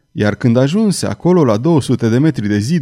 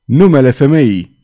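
loud, urgent demand; agitated loud outcry; shaken shout; 6 dB louder than usual. A narrator's reading. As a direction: cry it out forcefully, shaken. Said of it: "strigă cutremurat cavalerul".

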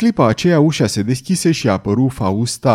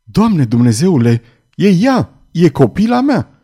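high, loud cry of anguished recognition; anguished shout rising to a peak; pained cry; 6 semitones higher, 4 dB louder than usual. A narrator's reading. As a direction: cry out in pain, voice leaping up. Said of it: "dădu un țipăt îndurerat".

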